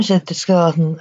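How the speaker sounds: usual speaking voice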